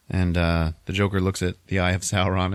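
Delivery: ordinary talk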